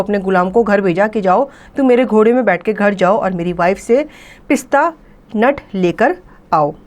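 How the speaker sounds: ordinary talk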